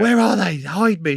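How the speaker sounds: high, urgent retold alarm; playful desperate plea; mock panic. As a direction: act out wanting to hide. Retold panic on hearing of guests.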